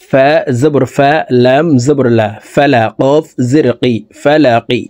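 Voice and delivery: ordinary talk, nothing marked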